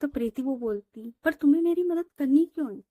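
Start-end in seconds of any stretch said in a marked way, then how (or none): 1.2-2.8 agitated question. Acted. Quarrel between Preeti and Ritika.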